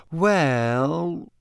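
long, hesitant "well"; Parvati, slow. A narrator's reading. Parvati considering a date for Ron, slowly. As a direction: stretch it while thinking. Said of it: "said Parvati slowly".

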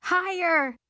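high, thrilled call; shouting. A narrator's reading. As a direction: shout your demand up front. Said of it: "I shout".

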